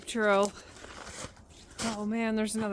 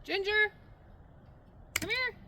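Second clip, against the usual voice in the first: high sing-song call to a dog; 11 semitones higher, 16 dB quieter than usual. Real, not acted. Calling the dog from afar.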